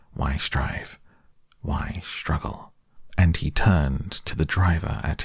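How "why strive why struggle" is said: said to himself. A narrator's reading. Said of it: "he said to himself".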